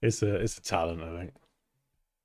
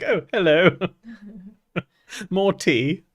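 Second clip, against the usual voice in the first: higher, louder imitation British accent; mock British accent; 7 semitones higher, 3 dB louder than usual. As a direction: imitate an American faking British. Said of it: "does a British accent".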